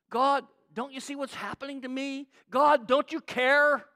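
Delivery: building from restrained plea to loud, high cry; rising desperation toward God; desperate plea. Acted. Acted prayer building to desperation.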